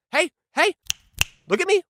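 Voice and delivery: put-on high, sing-song mom voice calling kids; mom's warning. Mom warning misbehaving kids, high mock voice. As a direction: mimic mom getting the kids' attention.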